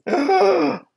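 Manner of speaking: sharp, very high-pitched wheezy gasp on the inhale; mimicked stridor. Shown as a demonstration. Medic mimics stridor for his students, a high gasping inhale. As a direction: fake a strangled inspiratory whoop. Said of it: "I'll mimic a str-, stridor right now just for you".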